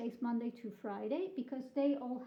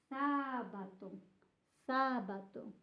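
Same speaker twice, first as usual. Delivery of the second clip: SABATO with a long, leaning first SA; stress on first syllable. Shown as a demonstration. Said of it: "where are the stresses here".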